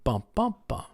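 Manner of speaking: bum-BUM-bum, soft; "Bum, bum, bum" with the middle beat strong; second-syllable stress pattern. Shown as a demonstration. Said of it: "say the second syllable".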